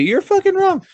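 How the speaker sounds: mock angry listener. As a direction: play a fan yelling a correction. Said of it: "tell me".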